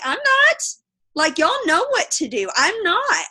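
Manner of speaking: high, loud flat-out no; emphatic refusal. Retold or imitated. Telling her new teammate she won't do Zooms, emphatic no. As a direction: shut the idea down hard.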